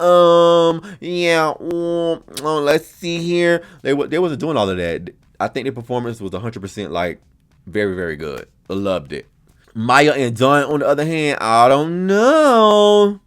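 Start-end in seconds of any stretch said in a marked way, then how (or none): 0.0-3.6 long, high, drawn-out ums; mock stumbling. Mimicking a contestant fumbling through a presentation, mock stumbling. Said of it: "didn't stumble over any words". 11.4-13.2 high, very slow, stretched hesitation; drawn-out doubt. Real, not acted. Host weighing up Maya and Dawn, drawn-out doubt. Voicing doubt about a pair.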